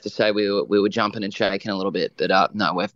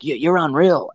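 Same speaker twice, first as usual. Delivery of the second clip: louder, hammed-up Aussie praise; full Aussie mode; 5 semitones higher, 3 dB louder than usual. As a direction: imitate an Aussie star praising the boys in broad Australian. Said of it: "went full Aussie mode".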